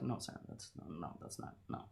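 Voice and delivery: unvoiced hushed imitation of a warning no; imitating the creator, barely whispered; whispered mock objection. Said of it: "The person that created this was like".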